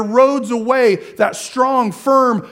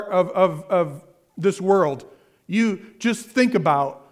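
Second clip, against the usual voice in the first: usual speaking voice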